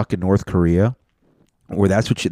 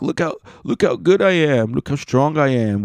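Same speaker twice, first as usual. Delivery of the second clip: high-pitched, put-on bragging; mock showing off; 7 semitones higher than usual. Jealous ex-boyfriend to his ex, mock showing off. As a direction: boast like an ex desperate to impress.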